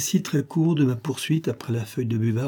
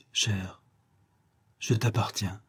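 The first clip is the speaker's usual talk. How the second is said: soft, hushed, intimate avowal; tender surrender; 3 semitones lower, 7 dB quieter, slower than usual. Acted. Woman taking his hands to pledge herself, tenderly. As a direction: let it out softly and sincerely.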